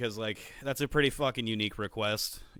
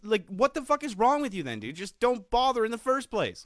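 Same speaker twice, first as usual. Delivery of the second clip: exasperated rant; 10 semitones higher, 3 dB louder than usual. Real, not acted. Ranting about flaky friends.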